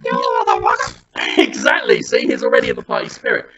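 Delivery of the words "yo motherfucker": very high, squeaky sped-up tape voice; fast-forwarded recording. Retold or imitated. Partygoer's talk boy played back, squeaky fast-forward. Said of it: "he does it fast forwards".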